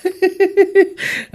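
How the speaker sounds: very high, sharp burst of laughter; shrill teasing laugh; high mocking laugh. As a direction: cackle at her mistake. Laughs at the public posts.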